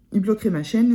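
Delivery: normal talking voice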